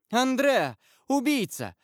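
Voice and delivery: high, alarmed cry; young woman's cry. A narrator's reading. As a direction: cry out in horror at an intruder. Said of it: "крикнула одна из молодых женщин".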